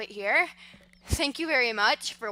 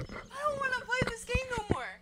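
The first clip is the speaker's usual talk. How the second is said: high, rattled voice; pitched up in fright; scared horror game streamer; 12 semitones higher, 9 dB quieter, faster than usual. Real, not acted. Just after the scare.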